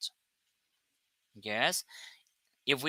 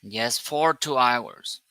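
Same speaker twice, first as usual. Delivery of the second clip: ordinary talk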